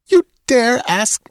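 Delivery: high, indignant outburst; angry. Acted. Girl flaring at Conan's demand for a kiss, high and angry. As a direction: flare up at his nerve. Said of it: "she began angrily".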